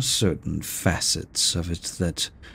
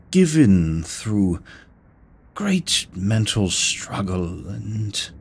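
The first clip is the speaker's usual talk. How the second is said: ordinary delivery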